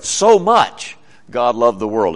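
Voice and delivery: SO MUCH hit hard, then a gap; front-loaded emphasis on So much; stress on SO MUCH. Shown as a demonstration. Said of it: "you hear the emphasis, right?".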